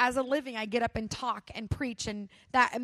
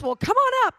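mock welcome; 11 semitones higher, 9 dB louder than usual. Imagined invitation to the stage.

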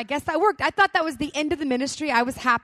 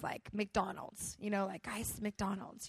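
dreamy daydream; 4 semitones lower, 13 dB quieter, slower than usual. Retold or imitated. Daydreaming during prayer.

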